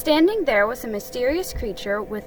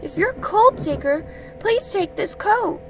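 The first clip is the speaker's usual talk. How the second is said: high-pitched small offering voice; Piglet, kindly; 9 semitones higher than usual. Acted. Small friend offering his coat, high and kind.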